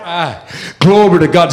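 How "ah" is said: ordinary talk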